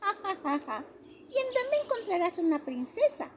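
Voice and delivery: put-on high child laughing then asking; mocking child laugh. A narrator's reading. The kids laugh at Johnny and challenge him, high teasing child voice. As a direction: tease like a schoolyard kid.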